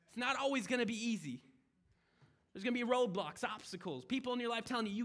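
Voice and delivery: rally the congregation; preaching at full force. Acted.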